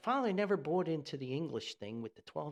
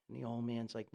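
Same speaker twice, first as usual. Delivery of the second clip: ordinary talk, nothing marked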